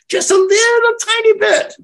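high squeak for a tiny bit; tiny squeaky voice. Shown as a demonstration. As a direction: make it sound teeny-tiny.

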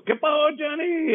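high, bright calling out of a hello; retold friendly greeting. Airport coworker hailing Johnny with a high friendly greeting. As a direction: call out the greeting like spotting a friend.

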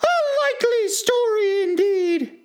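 very high, scornful bird voice; contemptuous pigeon. A narrator's reading. Pigeon dismissing Alice's claim, high contemptuous voice. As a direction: scoff in a piercingly high character voice. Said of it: "in a tone of the deepest contempt".

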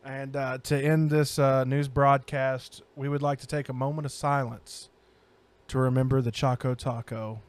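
low, grave memorial announcement; mock solemn. Host calls a moment of silence for a snack, mock solemn.

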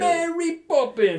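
high, loud, slow Mary Poppins cry; hammed-up Cockney call; mock Cockney shout. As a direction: squeal it like a Cockney chimney sweep. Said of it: "I was gonna say".

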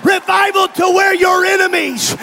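preaching. Acted. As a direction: preach it to the rafters. Preaching at full force.

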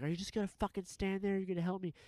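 high, urgent imitation of a woman; frantic demand. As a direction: mimic her panicked demand for help. Retold woman demanding help.

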